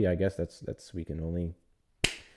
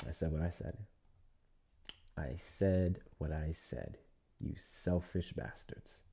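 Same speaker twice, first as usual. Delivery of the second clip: very soft, low mutter; hushed mock grievance; 5 semitones lower, 14 dB quieter than usual. Host mock-scolding selfish poll voters, in a low mutter.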